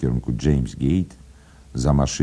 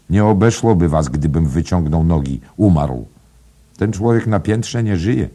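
raised, higher scolding voice; menacing tirade; 5 semitones higher, 6 dB louder than usual. A narrator's reading. Father rants at his ungrateful children, menacing and loud. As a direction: berate them loudly and threateningly. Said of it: "powiedział pan Dedalus groźnie".

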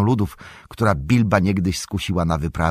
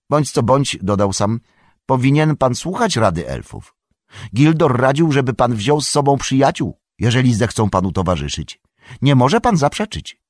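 higher, eager servant's voice, lightly done; earnest young hobbit, raised a little; lightly voiced Sam, eager; 6 semitones higher, 4 dB louder than usual. A narrator's reading. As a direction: Sam pressing his point, a touch higher. Sam's narrated voice again.

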